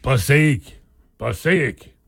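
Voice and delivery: lightly voiced old Western chief; movie Indian chief. Co-host imagining the chief naming Passaic, mock chief voice. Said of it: "Do your Indian chief voice".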